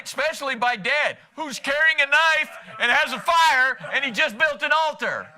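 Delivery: high, loud mock disbelief; loud, incredulous comic build-up; comic alarm. Jokes about teenager facing knife-carrying dad.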